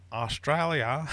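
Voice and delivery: hammed-up Aussie "Australia", high and loud with a rising end; Australian accent. Quizmaster denying the accent, then doing it again on purpose, Australian accent. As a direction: lay the Australian accent on thick. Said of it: "Definitely wasn't trying to do an Australian accent".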